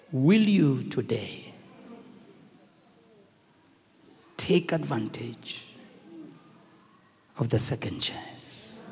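low, slow, soft question trailing to breath; hushed appeal. Acted. Preacher quietly asking listeners to take their second chance, hushed. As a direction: ask it gently and slowly, almost unvoiced. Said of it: "quiet closing question to listeners".

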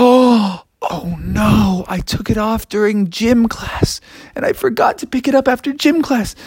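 high, whimpering, fading to a whisper; upset whimper. Acted. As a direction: whine it, then drop to a whisper. Froggy upset over lost jacket.

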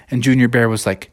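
usual speaking voice

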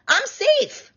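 squeaky high I'm safe; exasperated. Retold or imitated. Telling her overprotective husband she's safe, squeaking high. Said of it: "I'm like".

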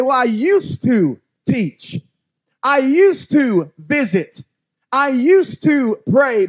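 slow, emphatic mocking lament; mock excuses. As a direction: stretch each excuse out mockingly.

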